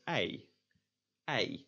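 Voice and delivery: "ei" starting at open e, gliding to ee; Dutch EI diphthong. Shown as a demonstration. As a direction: say "ei" the Dutch way, not like English I. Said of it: "is pronounced as".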